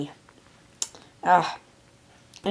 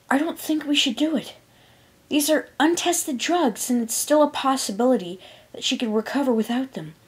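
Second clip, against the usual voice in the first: higher, measured objection; careful, higher-pitched unicorn voice; careful reply; 7 semitones higher than usual. A narrator's reading. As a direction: reason gently with an upset friend. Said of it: "the purple unicorn carefully replied".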